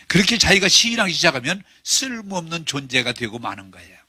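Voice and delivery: ordinary delivery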